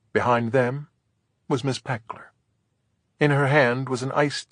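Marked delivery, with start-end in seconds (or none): none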